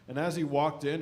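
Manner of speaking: normal talking voice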